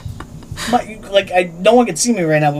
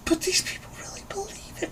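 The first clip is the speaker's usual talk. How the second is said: hushed whisper, pitched up; 13 semitones higher, 14 dB quieter than usual. Retold or imitated. Realising the old men truly believe it, whispered. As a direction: let it slip out as a stunned whisper. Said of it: "and I'm just like".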